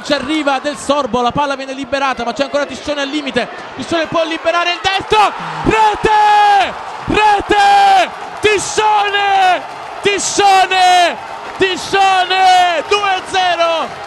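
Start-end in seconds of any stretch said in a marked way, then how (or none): 3.8-14.0 high, loud goal scream; goal call. Real, not acted. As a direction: bellow the scorer's name. (urlo del gol a squarciagola)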